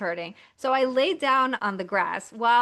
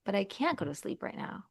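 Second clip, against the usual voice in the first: whisper; 7 dB quieter, faster than usual. Pretend. Tired inner voice.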